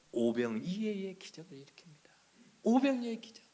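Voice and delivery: awed hushed whisper. Real, not acted. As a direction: whisper it like a secret wonder. Preacher whispers the miracle. (속삭이듯이)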